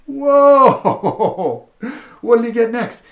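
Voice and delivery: loud, high amazed whoa with laughter; laughing whoa. Acted. Reading to a child, laughing loud surprise. Reacting to new animal.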